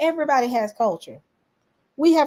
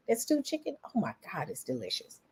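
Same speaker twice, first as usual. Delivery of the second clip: relishing the flavor, softer and softer; savoring hush; 13 dB quieter than usual. Retold or imitated. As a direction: drop to a near-murmur of delight. Savoring praise of friend's food.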